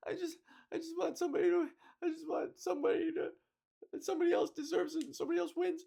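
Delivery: high, fast, tearful-sounding mock of the contestant; imitating crying woman. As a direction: parody her breaking down, rushed and high. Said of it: "She's like".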